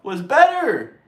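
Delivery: lightly voiced mock liberal; a hint of a mocking caricature; mocking Democrat voice. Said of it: "some of them are saying".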